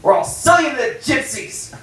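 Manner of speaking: high, loud barked threat; grandpa's threat. Retold or imitated. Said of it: "he's like".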